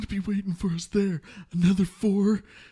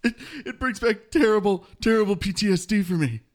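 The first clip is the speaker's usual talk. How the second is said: distressed loud answer; 8 dB louder than usual. Acted. Traumatized recruit answering.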